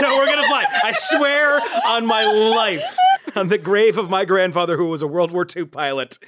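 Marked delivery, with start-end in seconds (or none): 0.0-3.0 laughing while promising. Real, not acted. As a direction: swear it through laughter. Laughing, swearing an oath.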